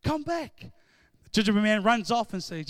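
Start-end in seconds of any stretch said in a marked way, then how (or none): 0.0-0.7 high, calling out after the runaway. Retold or imitated. Girl chasing the gingerbread man, calling out high. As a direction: call him back as he runs off. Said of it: "She says".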